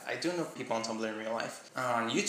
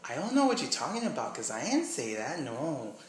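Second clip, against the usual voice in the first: Miami accent; 3 semitones higher than usual. Shown as a demonstration. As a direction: mimic a Miami speaker denying it. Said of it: "something from Miami might sound like".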